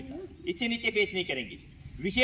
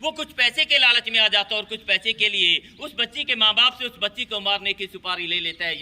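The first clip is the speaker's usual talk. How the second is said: ordinary talk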